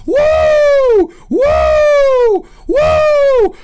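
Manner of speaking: high, loud wrestler whoop; Ric Flair woo. Retold or imitated. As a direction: let rip a pro-wrestling woo. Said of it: "We're doing Ric Flair style".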